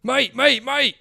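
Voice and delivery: loud, high British "mate" calls; mock British accent. UK bystanders during a scuffle, shouted British accent. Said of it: "these other U K guys are like".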